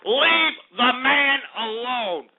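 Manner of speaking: usual speaking voice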